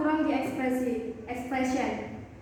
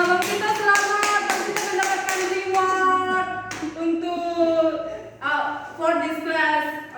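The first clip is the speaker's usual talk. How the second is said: louder, higher congratulatory announcement; celebratory announcement; 5 semitones higher, 4 dB louder, slower than usual. Real, not acted. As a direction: announce the winner with raised voice, drawn out at the end. Teacher announces a student's reward. (pengumuman selamat yang meriah)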